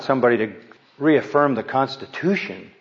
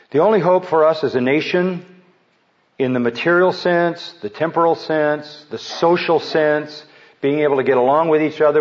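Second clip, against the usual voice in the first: rising sermon emphasis; 3 semitones higher than usual. Acted. Preacher to congregation, building fervent sermon emphasis. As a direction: press each sense harder toward the climax.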